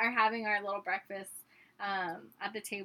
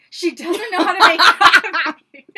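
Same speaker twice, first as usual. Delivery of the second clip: loud, high dad impression breaking into laughter; mock outrage, cracking up midway; annoyed dad; 7 semitones higher, 20 dB louder than usual. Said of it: "He was so annoyed".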